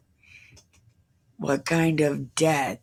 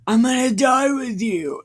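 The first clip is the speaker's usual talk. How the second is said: higher-pitched mocking imitation of Peter; mock bold vow; 7 semitones higher, 4 dB louder than usual. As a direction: make fun of a big heroic promise. Mocking Peter's boastful promise.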